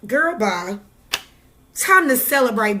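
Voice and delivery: ordinary talk, nothing marked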